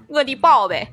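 用陕西口音说“我的宝贝”，重音和腔调带陕西味; 模仿陕西话说“我的宝贝”; Shaanxi accent. Shown as a demonstration. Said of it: "差不多是这么发音哈". (陕西口音念“我的宝贝”)

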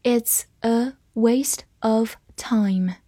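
word by word, evenly spaced; slow reading. Shown as a demonstration. Teacher reads along with learners word by word, slow reading. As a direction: read slowly with a gap after every word. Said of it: "我们慢慢来读".

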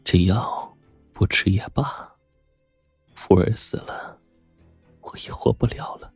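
soft, faint voice fading towards a whisper; weak, hopeless. A narrator's reading. Sick lady refuses her medicine, weak and hopeless. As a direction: refuse with no strength left.

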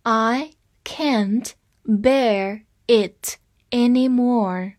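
slow, clear read for students to repeat; slow teacher read-along; read slowly. Shown as a demonstration. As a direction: stretch each word out for learners. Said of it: "跟我慢慢读一下".

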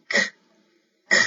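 crisp K sound, released at once with no long vowel; quick consonant. Shown as a demonstration. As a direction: say "k" as a quick, isolated burst, pausing between repeats. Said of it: "以快一点去拼读". (短促地读辅音k)

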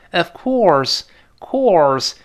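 "course" high, then falling; stress on COURSE. Shown as a demonstration. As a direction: lean on "course" and let it fall. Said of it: "这of course在这儿要重读了". (“course”重读，高起降下)

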